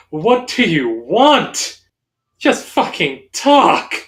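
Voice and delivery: slow, rising exasperated demand; acted anger, pitch climbing high; frustrated outburst. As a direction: draw out each word in frustration. Character yells at stalking beast.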